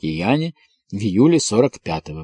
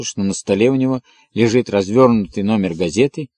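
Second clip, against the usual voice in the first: usual speaking voice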